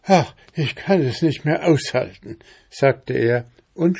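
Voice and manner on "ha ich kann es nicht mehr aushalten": higher, lightly voiced impatient fox; hungry outburst. A narrator's reading. Hungry fox eyeing the duck in the coach, impatient outburst. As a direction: burst out as if unable to hold back.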